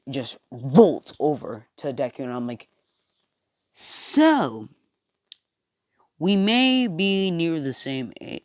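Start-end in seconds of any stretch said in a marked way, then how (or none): 3.7-8.4 higher, drawn-out lead-in; leading up to a proposal; acted overture. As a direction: stretch it out slowly, pitched up. Acted opening line to Deku.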